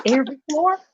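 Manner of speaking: high rising "Every floor?"; incredulous question. Retold or imitated. Comic questioning the thief's claim, incredulous "Every floor?". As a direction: ask "Every floor?" in disbelief, pitch jumping up. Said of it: "And I said".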